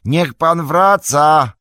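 high, loud, drawn-out shout; slow, long-held pleading yell; calling out. A narrator's reading. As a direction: call someone back from far off. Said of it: "gdzieś nad nim wołał Sam".

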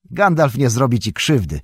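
usual speaking voice

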